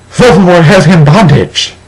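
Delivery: dramatic cry. Acted. Narrator crying out her vision of Draco, hammed-up alarm.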